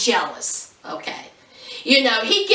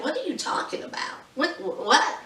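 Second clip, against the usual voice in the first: hushed, baffled stammer; mock confusion; 9 dB quieter than usual. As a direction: imitate her bewilderment, barely voiced. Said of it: "I'll be confused too".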